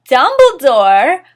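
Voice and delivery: loud, slow, hammed-up cry of the name Dumbledore; mock gasp. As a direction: shout the name like a shocked fan. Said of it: "we all gasped".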